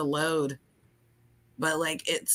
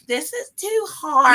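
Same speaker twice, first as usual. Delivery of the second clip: whiny; 7 semitones higher, 3 dB louder than usual. Real, not acted. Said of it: "you have to be, uh, not whiny".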